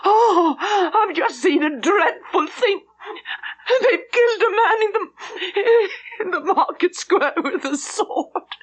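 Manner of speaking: high, tearful voice breaking into sobs; sobbing with shock. A narrator's reading. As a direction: gasp it out through shocked crying. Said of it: "almost incoherent with grief and shock".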